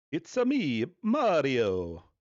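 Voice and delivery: high, loud It's-a me Mario; chirpy Italian plumber catchphrase; Mario impression. Streamer echoing the Mario ad, in a loud Mario voice. Mario commercial playing, imitating mascot.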